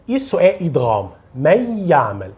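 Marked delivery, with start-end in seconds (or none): 1.3-2.3 من يعمل with a short oral y join; no nasal sound. Shown as a demonstration. Teacher shows idgham alone, non-nasal من يعمل. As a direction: merge من يعمل without any held hum. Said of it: "Não tem nenhum som nasal".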